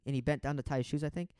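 normal talking voice

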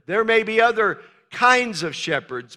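usual speaking voice

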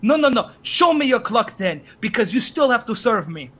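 imitated insistent customer. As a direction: demand it like an indignant shopper. Imitating customer demanding proof.

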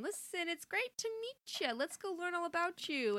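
high, showy host greeting; Sardonyx. Acted. Sardonyx welcoming the new fusion, high and showy. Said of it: "they become Sardonyx".

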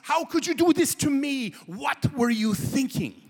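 loud, high, slow mock rant of a furious European; screaming and hollering. Tutor scolding the naked wine swimmers, hammed-up high shout. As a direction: ham up an outraged tutor scolding the students. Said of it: "he's screaming and hollering".

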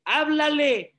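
high, impassioned sermon voice; heartfelt pulpit exhortation; preaching at full force. Acted. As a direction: plead with them to really talk to God. Pastor preaches at raised pitch.

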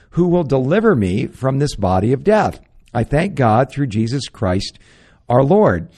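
loud, preaching Paul's words with full force; fervent scripture reading. Acted. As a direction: proclaim it from the pulpit. Reads Romans seven aloud.

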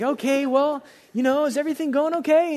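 trying hard to sound friendly; forced cheer. Retold or imitated. Pastor voiced on phone call.

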